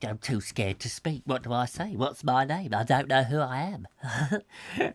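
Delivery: small, hesitant mock caller; imitating scared caller. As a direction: play a caller too scared to speak, quietly. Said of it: "somebody ring up and then go".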